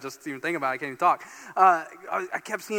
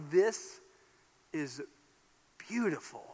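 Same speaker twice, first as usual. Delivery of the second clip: awed hush; 6 dB quieter than usual. Retold or imitated. Struck silent by the view.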